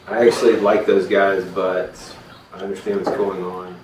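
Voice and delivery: reluctant, low and subdued homeowner; conflicted. Acted. Homeowner torn over the horse borrowers, conflicted and low. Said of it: "he's really conflicted".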